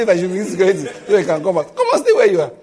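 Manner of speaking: loud mock reply, laughing through it; loud laughing quote. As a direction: belt it out, half laughing. Aunt's reply, laughing loudly.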